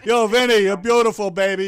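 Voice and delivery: loud, hyped greeting; excited shout. Real, not acted. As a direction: call out with big energy. Host greeting Brooklyn caller.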